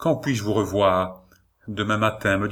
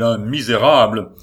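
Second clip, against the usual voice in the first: scornful shout, much louder; outraged cry; 2 semitones higher, 7 dB louder than usual. A narrator's reading. The marquis insults his rival, shouting with contempt. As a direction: fling the word with contempt. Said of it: "s'écria-t-il".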